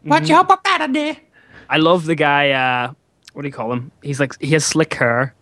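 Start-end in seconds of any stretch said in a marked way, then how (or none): 0.0-1.2 high prissy voice copied back; mock scolding echo. Echoing the friend's teasing in the same high voice. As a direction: parrot the squeaky scold.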